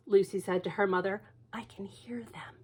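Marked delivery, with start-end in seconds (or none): 1.5-2.6 put-on scared child whispering; whispered. Lucy whispering to her mother she hears them. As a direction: breathe it fearfully.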